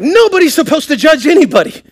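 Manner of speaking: high-pitched, exaggerated outcry; mock indignant protest. Preacher mimicking people who bristle at the word judge, mock indignant. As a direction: put on an indignant objector.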